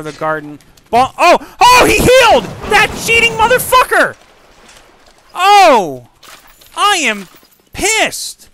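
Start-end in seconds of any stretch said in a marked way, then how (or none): 0.9-2.4 shocked shout. Real, not acted. Enemy heals mid-fight. 2.7-8.5 furious outburst. Real, not acted. Enemy heals, streamer rages.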